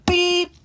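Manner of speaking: high, loud honk noise; car horn beep. Pretend. Kid playing a driver, honking at traffic with a loud beep.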